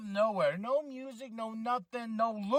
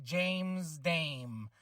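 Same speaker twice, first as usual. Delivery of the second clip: normal talking voice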